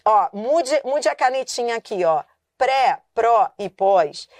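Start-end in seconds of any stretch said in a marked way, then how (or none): 2.5-4.3 PRÉ, PRÓ, PÓS each stretched slowly with force; strong stressed syllables. Shown as a demonstration. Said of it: "se eles forem pronunciados com força".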